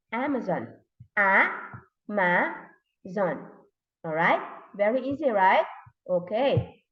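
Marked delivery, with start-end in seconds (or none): 1.2-3.4 "A, ma, zon" in three separate beats; syllable by syllable. Shown as a demonstration. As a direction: break "A, ma, zon" apart with clear pauses. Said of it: "How many syllables are there".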